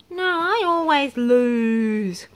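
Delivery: slow, sulky grumble; grumbling flamingo. A narrator's reading. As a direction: drag it out like a sore loser. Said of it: "grumbled Flamingo".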